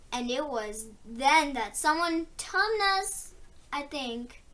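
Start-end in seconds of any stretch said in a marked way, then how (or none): none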